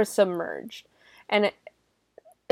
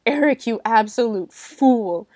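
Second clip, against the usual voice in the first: mock scorn; 5 dB louder than usual. Reading her own scornful note.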